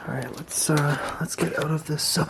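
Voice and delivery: unvoiced urgent whisper; whisper, wanting out; nervous night vlog whisper. Real, not acted. Leaving the cell whispering.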